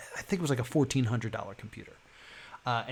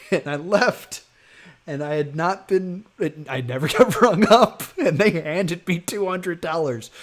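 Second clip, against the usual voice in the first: laughing through the words, louder; laughing disbelief; 5 semitones higher, 7 dB louder than usual. Real, not acted. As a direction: chuckle as you tell the punchline. Laughing at the freebie.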